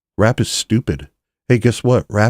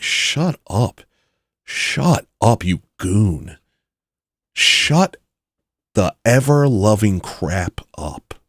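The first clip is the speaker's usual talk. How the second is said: mock exasperated; 2 semitones higher, much slower than usual. Real, not acted. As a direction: stretch it out in exasperation. Ranting at the host.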